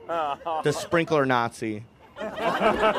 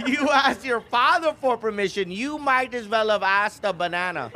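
high, scornful mock-mom scolding; hammed-up maternal disbelief; pissed mom; 6 semitones higher than usual. Mom dismisses dad's permission, mock pissed. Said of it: "she just lands at a sidewalk pissed".

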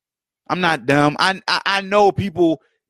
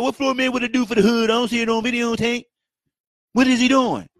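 higher, teasing challenge to Floyd Mayweather; mock critic; 7 semitones higher than usual. Heckler taunting Floyd Mayweather in a higher mock voice.

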